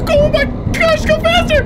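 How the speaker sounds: high frightened yell; panicked cry. Real, not acted. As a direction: plead in panic. Chased, desperate.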